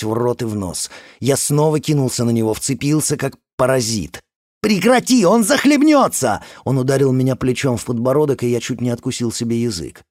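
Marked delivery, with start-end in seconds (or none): 4.6-6.4 panicked shout. Acted. Trying to stop a drowning.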